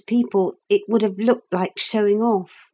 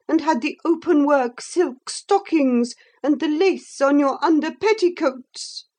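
high, sulky common woman's voice; a resentful servant girl pleading her case; pitched-up narrated Pretenderette; Pretenderette's voice; 8 semitones higher than usual. A narrator's reading. Pretenderette voice held.

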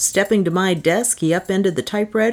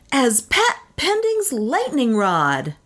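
high, slow, pompous unveiling; grandiloquent; 7 semitones higher, slower than usual. A narrator's reading. Inventor names his new invention, grandiloquent. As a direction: unveil the name slowly and grandly. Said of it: "said Pat grandiloquently".

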